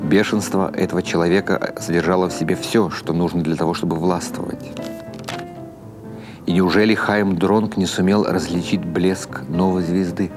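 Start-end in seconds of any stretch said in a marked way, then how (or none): none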